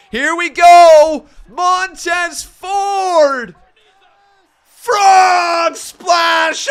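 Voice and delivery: high, loud ringside calling; play-by-play shouting. Acted. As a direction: scream the big spot live. Live wrestling play-by-play.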